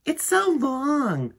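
high, slow mocking kid; teasing child. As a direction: drag it out to tease her. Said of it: "said Joe".

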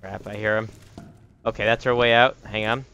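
usual speaking voice

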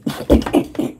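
unpitched stuttering laugh; demonstrated laugh. Shown as a demonstration. Streamer showing his own reaction, choppy laugh. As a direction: show your own reaction as a choppy, voiceless cackle. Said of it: "this is more my reaction".

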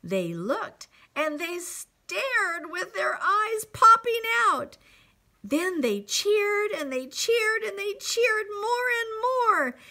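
high, excited narration; story read to children. A narrator's reading. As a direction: build the wonder with growing energy. Reads picture book to children.

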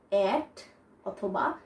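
ordinary delivery

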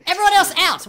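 shouting. Acted. Shooing everyone out of room.

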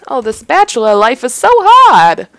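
loud, high theatrical lament; mock wail. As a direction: wail it, swelling into a hammed-up cry. Mock lament over bachelor life.

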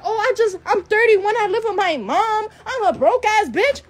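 high mocking imitation of her confession; mimicking her. Mocking the woman who called her out, high mimicry. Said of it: "She said".

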